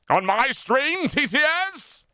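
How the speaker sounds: high-pitched outrage at being interrupted; incredulous squawk rising high; indignant outburst. Real, not acted. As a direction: demand it in a high, offended voice. Outraged at TTS interrupting him.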